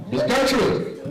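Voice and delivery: high call breaking into laughter; laughing call. Retold or imitated. Manager calling the resident back, laughing. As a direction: call her back while cracking up.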